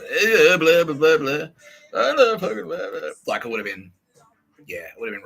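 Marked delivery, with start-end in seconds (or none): 0.0-3.1 higher, louder hammed-up blabbering; mock gushing babble. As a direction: play a gushing blabbermouth who can't stop. Mocks himself blabbing openly.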